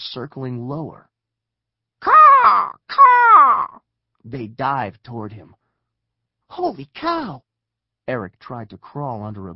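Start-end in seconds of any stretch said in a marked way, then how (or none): 2.0-3.8 very high, very loud caws; lizard screech. A narrator's reading. Giant lizards diving at Eric, piercing loud screeches. 6.5-7.4 high cry of shock; alarmed exclamation. Acted. Eric as lizards dive toward him, high startled cry.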